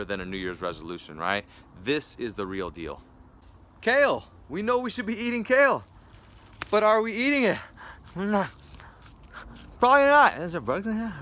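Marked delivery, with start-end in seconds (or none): 3.8-11.1 shrill comic voice. Acted. Comedic kale bit, shrill voice.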